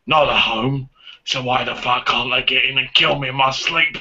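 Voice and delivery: a hint of a sulky Corey Feldman; Corey Feldman impression. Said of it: "do your impression of Corey Feldman".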